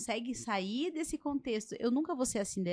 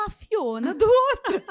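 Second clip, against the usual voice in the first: higher and louder, breaking into laughter; laughing punchline; 10 semitones higher, 11 dB louder than usual. Real, not acted. Telling friends the Cinderella and Fiona joke, laughing. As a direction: crack up on the punchline. Punchline of funny retold story.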